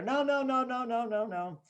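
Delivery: high, rapid-fire mock protest; whiny kid shutting out the parent; refusing child. Child won't hear "get it later", whiny refusal.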